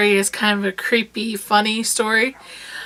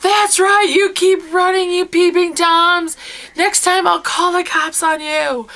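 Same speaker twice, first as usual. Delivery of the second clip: mock-furious screaming after prowlers; yelling at the creeps; 8 semitones higher than usual. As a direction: chase them off with a shout. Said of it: "I scream out bloody murder".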